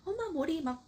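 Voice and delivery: put-on high, wailing child voice; crying daughter. Daughter crying at mom's hair, high child wail. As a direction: wail like a frightened little girl. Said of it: "막 대성통곡을 하고 우는 거예요".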